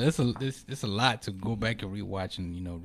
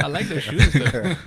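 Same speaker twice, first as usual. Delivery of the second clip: laughing; 5 semitones higher, 10 dB louder, slower than usual. Real, not acted. Teasing laugh about shoes.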